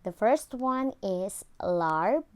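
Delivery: normal talking voice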